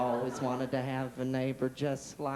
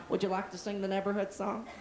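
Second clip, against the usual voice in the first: Mister Rogers voice; 5 semitones higher than usual. Acted. Mr. Rogers voice, far, higher.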